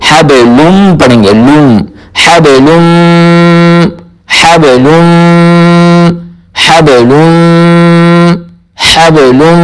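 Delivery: حبلم with lam joined to a nasal held م; teacher modelling the word with ghunnah; nasal ghunnah demo. Shown as a demonstration. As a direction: hum the م through the nose. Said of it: "تو ہم اس کو".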